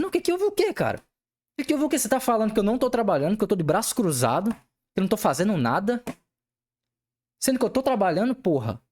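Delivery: angry confrontation. Retold or imitated. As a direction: throw the question back, incensed. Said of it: "Falei num tom de, de raiva".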